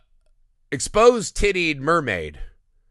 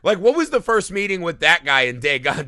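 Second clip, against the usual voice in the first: normal talking voice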